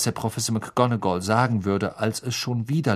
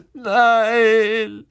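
high, loud sobbed no; sobbing; 12 semitones higher, 7 dB louder than usual. Acted. Lavender sobbing her answer to Hermine, sobbing. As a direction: sob the word out. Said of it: "schluchzte Lavender".

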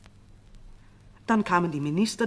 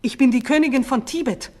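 much higher and louder, worked-up proud claim; agitated declaration; 8 semitones higher, 11 dB louder than usual. A narrator's reading. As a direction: declare her birthright in agitation. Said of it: "vor Erregung heiserer Stimme".

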